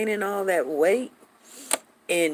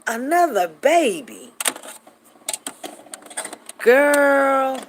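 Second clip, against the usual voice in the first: high, loud, drawn-out disbelief; incredulous exclamation; 7 semitones higher, 6 dB louder, much slower than usual. Retold or imitated. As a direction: stretch it out, loud and high, scandalized. Exclaims in shock at baby news.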